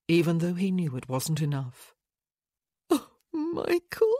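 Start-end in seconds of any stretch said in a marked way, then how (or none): 2.9-4.2 high, slow, crying wail; sobbed. A narrator's reading. Widow clinging to friend, sobbing. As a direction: sob his name out. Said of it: "she sobbed".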